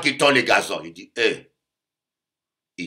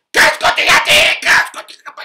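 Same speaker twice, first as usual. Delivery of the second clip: much higher, louder screeched mock yell; mock furious shout; 10 dB louder than usual. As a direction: screech at him like a furious wife. Angry wife with knife, shouting.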